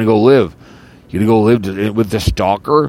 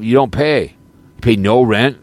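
normal talking voice

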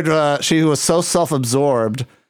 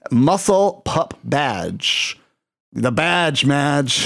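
drawn out slowly; slow announcer; much slower than usual. Acted. Host announcing the guest's handle, slow showman read. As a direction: savor each word like a sign-off. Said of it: "announcing the guest's handle".

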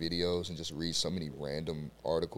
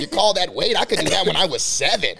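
loud, higher mocking coach taunt; coach impression; 7 semitones higher, 8 dB louder than usual. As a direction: scoff at the lift like a tough coach. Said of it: "impressions on".

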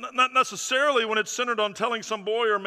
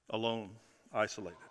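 hushed and lonely; 9 semitones lower, 11 dB quieter than usual. Acted. Preacher dwells on loneliness.

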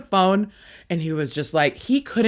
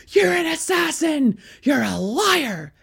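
imitating an irate dad, high and strained; high retold shout, stretching the insult; mock accusing yell; 9 semitones higher than usual. Dad calls his daughter a liar, mock accusing yell.

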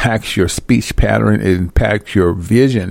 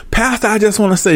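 fawning church lady; 10 semitones higher, faster than usual. Retold or imitated. Woman fawning over the pastor.

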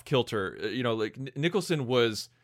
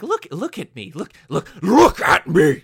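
Joker impression; 8 semitones higher, 11 dB louder than usual. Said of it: "it's like".

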